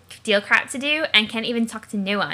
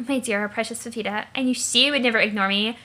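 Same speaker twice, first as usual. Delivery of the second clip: ordinary talk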